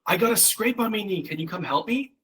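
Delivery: put-on high little-girl voice asking for help; hurt child asking. Daughter with a scraped knee asking mom for help, high childish plea. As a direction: play the kid with a scraped knee.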